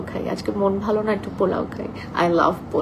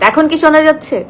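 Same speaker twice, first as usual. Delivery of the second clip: raised, higher voice to be heard; asking viewers if the sound is clear, louder; louder check; 6 semitones higher, 6 dB louder than usual. Real, not acted. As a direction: speak up so the viewers can hear. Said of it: "আমি আস্তে আস্তে কথা বলি".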